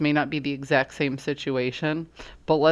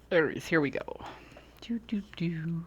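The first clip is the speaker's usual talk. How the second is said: soft hushed whisper; 2 semitones higher, 8 dB quieter than usual. Acted. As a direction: breathe it like a secret. Tarot reader whispers her intro.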